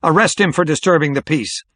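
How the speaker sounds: high, angry order from the mayor; outraged official calling for an arrest; crying out. A narrator's reading. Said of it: "the mayor cried".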